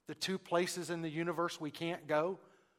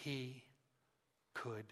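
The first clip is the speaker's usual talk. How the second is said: soft, word by word with long pauses; hushed, deliberate; 4 semitones lower, 10 dB quieter, slower than usual. Real, not acted. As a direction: let each word land quietly, slowing right down. Solemn key point of sermon.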